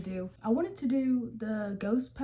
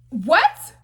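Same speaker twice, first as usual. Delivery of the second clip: shocked what; 5 semitones higher, 10 dB louder than usual. Real, not acted. Stunned reaction to the story.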